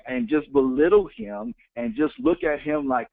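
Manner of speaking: ordinary talk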